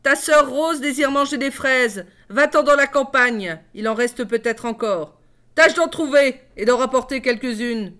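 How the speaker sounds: haughty harsh stepmother. Acted. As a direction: look down and lash her with contempt. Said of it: "dit la marâtre d'un ton rogue".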